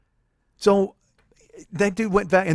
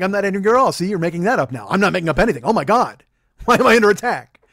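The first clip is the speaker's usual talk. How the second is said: louder, rising, hammed-up indignation; mock defensive outburst; 4 semitones higher, 7 dB louder than usual. Husband mimicking himself denying anger to Jill, mock indignant. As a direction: protest too much, getting louder. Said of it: "And she's like".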